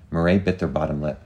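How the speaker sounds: usual speaking voice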